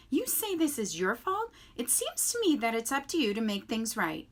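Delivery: high, loud stern goose voice; scolding old goose. A narrator's reading. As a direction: lecture the robot as a bossy old goose.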